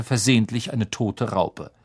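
usual speaking voice

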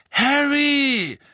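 high, loud joyful call; calling out gladly. Acted. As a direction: call his name across the room. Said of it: "rief Mister Weasley".

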